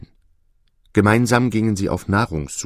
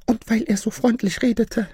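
very high-pitched goose voice; troubled goose storyteller; 14 semitones higher than usual. Acted. Goose telling the peacocks about her dream, troubled.

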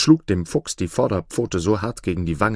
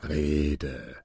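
low, softer bored wolf; 5 semitones lower, 6 dB quieter than usual. A narrator's reading. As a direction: grant it with a bored sigh. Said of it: "erwiderte der Wolf gelangweilt". (gelangweilter Wolf, tief und leise)

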